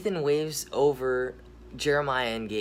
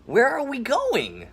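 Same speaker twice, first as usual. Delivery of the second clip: much higher cartoon gator voice; gator character voice; 12 semitones higher, 6 dB louder than usual. Gator greeting the boys, high goofy cartoon voice. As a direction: pitch it way up, friendly and goofy. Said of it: "Nathan says".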